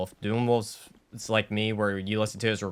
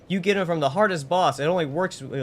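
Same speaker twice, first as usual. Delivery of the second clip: higher, louder, hyped-up delivery; excited performance; 8 semitones higher, 4 dB louder than usual. Shown as a demonstration. Streamer showing viewers their excited scripted voice, hyped up. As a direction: sell it with punchy, high-energy emphasis. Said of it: "get, like, excited".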